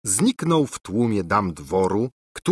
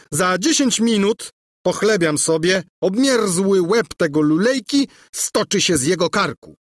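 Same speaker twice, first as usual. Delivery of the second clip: high, loud ranting boast; snarling, hungry for blood; bloodthirsty prince; 8 semitones higher, 4 dB louder than usual. A narrator's reading. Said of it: "wołał dyszący pragnieniem". (żądny krwi książę)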